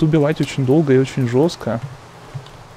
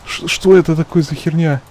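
alarmed; 2 semitones higher, 6 dB louder than usual. Real, not acted. Sudden alarm in game.

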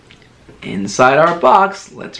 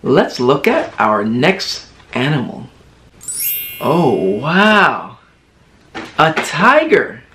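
usual speaking voice

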